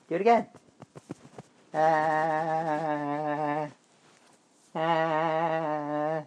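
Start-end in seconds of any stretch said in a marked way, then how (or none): none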